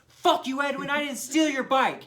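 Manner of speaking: loud furious shout at Edwin; angry yell. Retold or imitated. Shouting at Edwin in public, furious yell. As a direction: yell it in his face, fed up.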